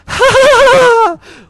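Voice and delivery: very high, loud put-on laugh; mock a woman's piercing, startling "ha ha" laugh; imitated startling laugh. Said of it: "exactly how this lady laughed".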